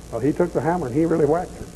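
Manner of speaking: ordinary talk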